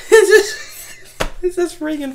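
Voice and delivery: high, loud laugh through words. Real, not acted. Retelling a funny scene, laughing loudly.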